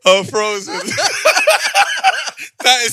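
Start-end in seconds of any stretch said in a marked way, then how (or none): 0.8-2.6 high burst of laughter; laughing in disbelief at the joke. Real, not acted. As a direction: crack up loudly. Reacting to the video.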